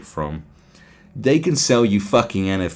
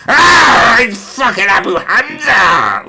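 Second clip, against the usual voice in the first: much higher, louder terrified yell; slow, loud, screaming panic; mock panicked shout; 12 semitones higher, 9 dB louder, slower than usual. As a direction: shriek like a terrified Brit.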